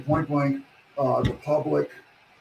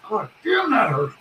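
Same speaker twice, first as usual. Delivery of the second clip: pained yelp; 7 semitones higher, 4 dB louder, slower than usual. Retold or imitated. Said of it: "he goes".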